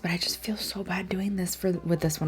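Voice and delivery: soft unvoiced whisper. Real, not acted. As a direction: whisper it secretly. Hushed intro over music.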